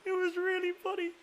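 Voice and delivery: squeaky high voice, giggling through it; high laughing reply. Retold or imitated. Laughing while retelling the joke to a friend. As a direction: pitch way up, laughing at yourself. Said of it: "I was like".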